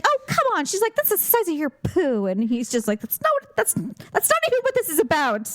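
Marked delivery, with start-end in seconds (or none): 0.0-2.3 high-pitched mock exasperation; imitated exasperated vet. Vet answering the offended dog, imitated exasperation. 3.0-5.5 high-pitched mock indignation; protesting dog voice. Dog arguing back at the vet, mock indignant.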